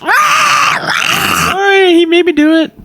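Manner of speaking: very high, sheepish little voice; squeaky sword voice. Acted. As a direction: squeak out the apology. Player voices apologetic sword.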